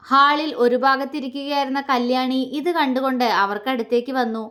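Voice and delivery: usual speaking voice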